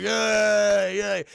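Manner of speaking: drawn-out half-hearted hooray; cheering crowd. Retold or imitated. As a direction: imitate townsfolk cheering. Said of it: "Everyone's like".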